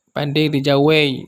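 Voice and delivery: logat Minang Akuranji, pelan; dialek Akuranji. Retold or imitated. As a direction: ucapkan Pade di Jawei dengan logat Minang Akuranji. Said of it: "ada bunyi seperti itu". (tiruan ringan logat Minang Akuranji)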